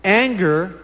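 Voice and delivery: ordinary talk, nothing marked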